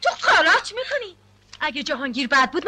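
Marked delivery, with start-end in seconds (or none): 0.0-1.2 sharp, higher and louder rebuke; mother furious at her daughter's refusal; angry scolding. Acted. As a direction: slap her down with the words.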